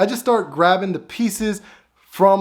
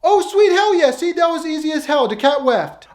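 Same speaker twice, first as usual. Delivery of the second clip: high, loud cartoon voice, gleeful; Tyrone; 11 semitones higher, 4 dB louder than usual. Tyrone glad the cat left, high and gleeful. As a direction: crow with delight in a much higher voice. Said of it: "here goes Tyrone".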